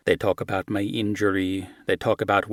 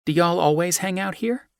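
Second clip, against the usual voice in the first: higher, drawling Southern-tinged question; young American woman; 9 semitones higher than usual. Acted. Ruby asking Luciano about the stands, higher and curious. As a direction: ask with easy curiosity.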